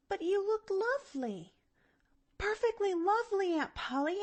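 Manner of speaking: dismayed. A narrator's reading. Girl reassuring her angry aunt, dismayed. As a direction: plead in a small high voice. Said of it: "Pollyanna stopped in dismay".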